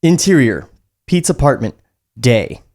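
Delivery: usual speaking voice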